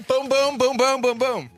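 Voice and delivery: loud rhythmic boom boom boom; hammering noises. Pretend. Host miming hammering off the door, loud rhythmic booms. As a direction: pound out each boom like a hammer.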